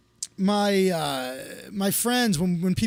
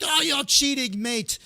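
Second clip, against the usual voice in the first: higher-pitched mock British accusation; British accent; 7 semitones higher than usual. British friend accusing him of cheating at a memory trick, mock British accent. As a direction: put on a British mate's accent. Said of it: "He's British".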